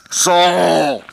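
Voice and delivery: booming, echoing voice from above; God voice. Acted. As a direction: boom it out like God from heaven. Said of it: "That's my God voice".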